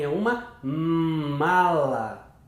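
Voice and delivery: "mala" very slow, the "m" stretched out; exaggerated first sound. Shown as a demonstration. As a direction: drag out "é uma mala", leaning on the first sound. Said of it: "vai exagerar no som, no sonzinho inicial". ("é uma mala" bem devagar, exagerando o "m" inicial)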